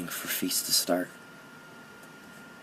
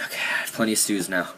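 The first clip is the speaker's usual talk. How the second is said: ordinary talk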